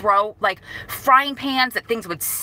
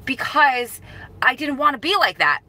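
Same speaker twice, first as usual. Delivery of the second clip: higher, upset retold lament; mildly frantic self-reproach, pitched up; emotional; 5 semitones higher than usual. Searching frantically for mom's handwriting, emotional. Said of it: "getting emotional".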